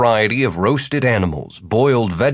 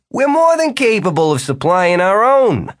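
sly jovial host voice; 10 semitones higher, 4 dB louder than usual. A narrator's reading. Jimmy's sly remark.

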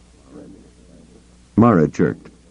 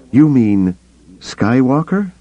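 shocked question; 7 semitones higher than usual. Acted. Mara shocked at the revelation.

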